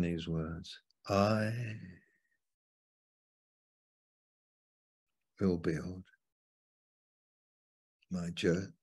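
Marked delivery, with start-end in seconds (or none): 1.1-8.7 "I" set apart by a long pause before "will build my church"; stress on I. Shown as a demonstration. Preacher showing emphasis in Jesus's words, stress on "I". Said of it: "I suppose I could emphasize".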